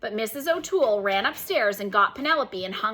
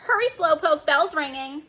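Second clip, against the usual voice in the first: yelling parrot; 5 semitones higher than usual. Acted. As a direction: shout it after her like a parrot. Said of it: "Penelope yelled after her".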